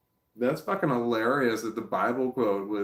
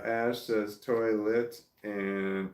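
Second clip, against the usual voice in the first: normal talking voice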